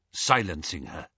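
usual speaking voice

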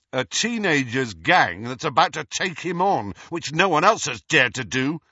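mildly heated argument; firm retort. A narrator's reading. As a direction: turn the words back in defence. Fred retorts to his mother's words.

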